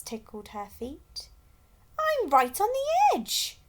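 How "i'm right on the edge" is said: much higher, louder complaining penguin; grumbling. A narrator's reading. Gasper squashed at the edge, high loud grumble. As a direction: grumble about the squeeze. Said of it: "grumbled Gasper".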